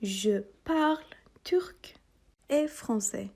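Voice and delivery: slow, clear French for repeating; slow model phrase. Shown as a demonstration. Teacher to students, slow repeat-after-me French. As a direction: model each word slowly for the class. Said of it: "Répétez après moi".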